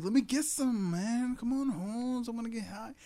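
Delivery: high, pleading stoner whine; a stoner begging his friend for coke, high whiny voice. Retold or imitated. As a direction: beg like a stoner who wants a hit. Voicing begging stoner in movie.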